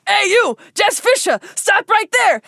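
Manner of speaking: mock pursuer shout. Child catcher yelling at Jess to stop, mock shout. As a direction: call after her like a pursuer. Chaser calling out in skit.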